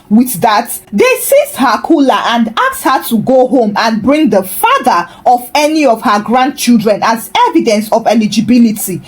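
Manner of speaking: plain reading voice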